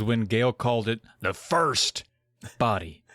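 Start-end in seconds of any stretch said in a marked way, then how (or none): none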